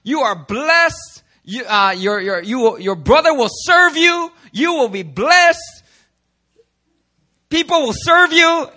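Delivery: put-on old Isaac blessing. Father Isaac blesses his son Jacob, grand and solemn. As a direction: hand down a grand blessing, rising in pitch and volume. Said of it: "Isaac says".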